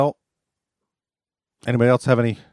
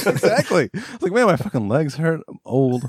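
laughing; 8 semitones higher than usual. Real, not acted. Laughing, mock complaint.

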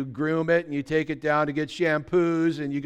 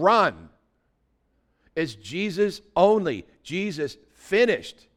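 ordinary delivery

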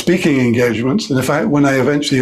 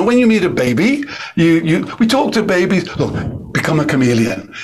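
ordinary delivery

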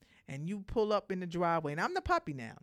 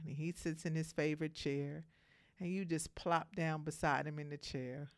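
ordinary talk

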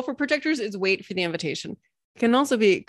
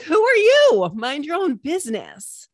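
snippy squirrel; 7 semitones higher, 4 dB louder than usual. Pretend. Startled squirrel snaps back.

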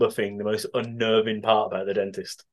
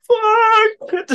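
high, loud yelled curse; mock yell; 8 dB louder than usual. Inventor on a failed test drive, mock yell. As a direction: yell out as it all goes wrong.

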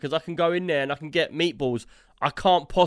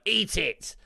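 stern nan; 5 semitones higher than usual. Retold or imitated. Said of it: "she'd be very stern".

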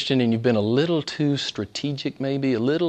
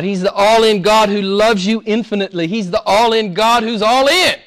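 rising, louder proclamation; building preaching; 6 semitones higher, 4 dB louder than usual. Acted. Preacher declares God all in to the church, building. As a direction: build each line higher.

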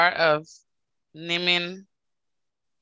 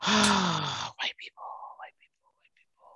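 fading to a whisper; 6 dB quieter, slower than usual. Pretend. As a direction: trail off until it's only a whisper. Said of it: "fading into a whisper".

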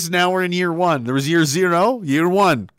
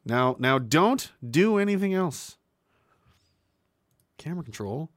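ordinary delivery

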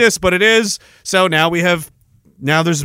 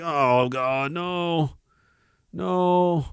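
quiet dread; 8 dB quieter, much slower than usual. Retold or imitated. Dread at spam.

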